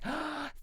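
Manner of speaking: high little gasp of delight. Retold or imitated. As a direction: gasp like a happy sapling. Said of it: "it was like".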